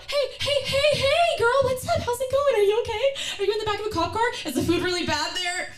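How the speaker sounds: hammed-up bubbly caller; overexcited phone greeting. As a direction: gush at her on the phone. Phone call, fake cheer.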